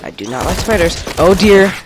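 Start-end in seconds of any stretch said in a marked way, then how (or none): 1.2-1.7 sudden loud high yelp; startled cry. Real, not acted. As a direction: jump in real fright. Spider jumps at him.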